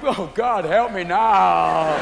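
drawn-out mock wail for help; stoned plea. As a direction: stretch the last words into a helpless moan. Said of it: "you're inside stoned going".

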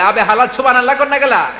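mock shout in Old Dhaka dialect; imitated Old Dhaka shout. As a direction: call it out like an Old Dhaka heckler. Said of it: "এরা চিৎকার দিয়ে মাঝে মাঝে বলে".

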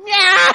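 high shouted cat screech and laugh; screeching meow. Pretend. Cat player screeches as the hobo attacks. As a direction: yowl like a hurt cat.

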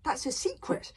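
put-on a touch of young defensiveness; uncomfortable. A narrator's reading. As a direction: admit it with mild discomfort. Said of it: "said Hiccup uncomfortably".

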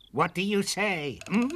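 high, needling witch demand; menacing witch awaiting a reply; unpleasant witch. A narrator's reading. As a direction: press for an answer with a nasty witchy hum. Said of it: "in a rather unpleasant tone".

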